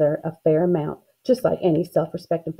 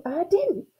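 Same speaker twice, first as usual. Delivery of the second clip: squabbling denial; 10 semitones higher, 4 dB quieter than usual. A narrator's reading. Sisters squabbling back and forth.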